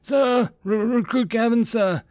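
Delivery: high, strained military reply; shocked recruit calling back; pained recruit. A narrator's reading. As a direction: stammer out the answer through pain. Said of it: "Gavin gritted his teeth in pain".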